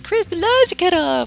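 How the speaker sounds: high, slow whiny complaint; mock the complaining fans; imitating angry viewers. Viewers complaining to Chris, mimicked in a high whine. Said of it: "You guys get mad at me and be like".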